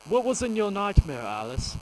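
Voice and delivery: a questioning put-on shrink voice, lightly done; mock therapist voice. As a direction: play a psychiatrist probing a dream. Mock therapist asking about nightmare.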